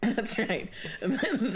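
ordinary talk, nothing marked

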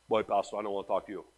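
a hint of a sulky official; grumbling politician. Politician tired of the pastor's preaching, low grumble.

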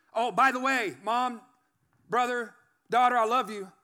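acted shout of love, tacked on; offhand call to family. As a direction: call it out to the family in separate bursts.

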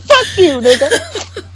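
a hint of the film's curse; imitated delivery. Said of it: "Such a perfect delivery".

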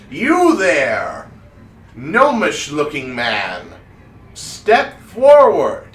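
loud, slow, theatrical summons; commanding wizard showman. Acted. Wizard picking a gnome from the audience, grandly commanding. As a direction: call him out with big drawn-out swoops. Wizard picks a volunteer, calling out.

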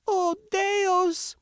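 put-on very high, girlish cry of alarm; spouted outcry. A narrator's reading. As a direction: exclaim it way up high in shock. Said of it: "Maylee spouted".

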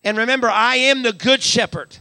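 usual speaking voice

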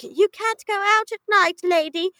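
put-on high-pitched voice of warning kids; mock children. Kids warning their nanny about night, high mock child voice. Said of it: "And they're like".

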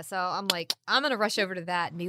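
ordinary delivery

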